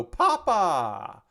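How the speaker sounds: high, drawn-out delighted call; Charles. Retold or imitated. Charles greeting Miyamoto as he walks in, high playful call. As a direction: call out high, stretching the last syllable. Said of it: "hear Charles go".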